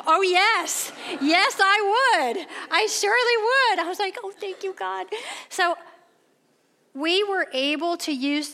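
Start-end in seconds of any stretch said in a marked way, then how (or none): 0.1-3.9 much higher and louder gushing yes; overjoyed, milk every yes slowly; mock-gushing shopper thrilled by the coupon; hammed-up delight. Eager reply to coupon offer. 4.2-5.1 relieved thanks. Retold or imitated. Relieved prayer.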